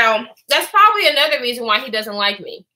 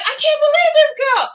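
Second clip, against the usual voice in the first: high-pitched incredulous exclamation; comically flabbergasted; mock disbelief; 12 semitones higher than usual. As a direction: react in exaggerated disbelief, pitched way up. Acts out own disbelief.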